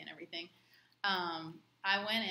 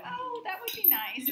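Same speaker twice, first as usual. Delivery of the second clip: flattered; 13 semitones higher, 6 dB louder than usual. Retold or imitated. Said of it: "I'm like".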